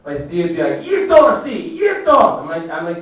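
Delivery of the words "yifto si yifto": high, loud mock scolding; imitated aunt's shout. Aunt voiced calling him Yifto.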